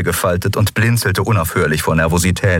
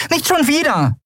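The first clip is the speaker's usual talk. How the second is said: much higher character voice; urgent crew member. A narrator's reading. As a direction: insist urgently in a high voice. Crew member urges captain to pursue. (hohe, drängende Figurenstimme)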